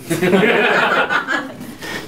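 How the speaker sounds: rising giggle; short high laugh. Real, not acted. Speaker laughs.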